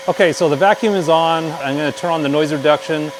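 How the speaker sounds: speaking up over a vacuum. Real, not acted. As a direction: project over the background noise. Talking over a running vacuum.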